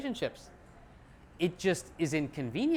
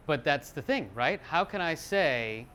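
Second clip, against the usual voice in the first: usual speaking voice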